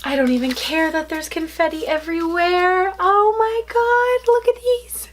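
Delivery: high squealing gasp and cry; shrieking jackpot winner. Real, not acted. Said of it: "Sorry if I sound like a little kid".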